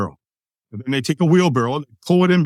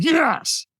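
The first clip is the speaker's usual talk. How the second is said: much higher, more forceful yes; stronger yes; 12 semitones higher than usual. Retold or imitated. Storyteller answering the trainer's demand for more, stronger high-pitched yes. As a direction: push the affirmation up high.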